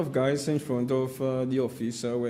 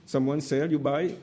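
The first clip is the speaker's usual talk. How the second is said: normal talking voice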